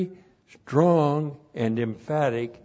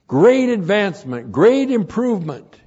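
higher and louder, GREAT stressed; emphatic repeat; 6 semitones higher, 5 dB louder than usual. Real, not acted. Preacher pressing home the believer's progress, emphatic. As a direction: hammer "great" each time it comes.

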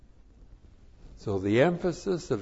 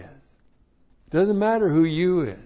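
much higher and louder, punching matter; preaching shout; 8 semitones higher, 8 dB louder than usual. Real, not acted. Preacher driving the point home to the congregation, shouting. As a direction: hammer it home, full-force preacher.

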